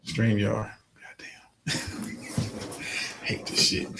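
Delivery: annoyed whisper to himself; whispered muttering. Real, not acted. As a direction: mutter it under your breath, unvoiced. Muttering to self off mic.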